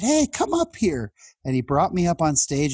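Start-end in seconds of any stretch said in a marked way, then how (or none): 0.0-1.1 much higher, slowish playful summons; teasing speaker. Retold or imitated. Speaker calling teen onto stage, high playful summons. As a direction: beckon him up with a bright mock call. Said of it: "And he said".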